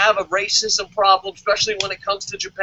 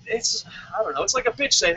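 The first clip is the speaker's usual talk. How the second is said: ordinary delivery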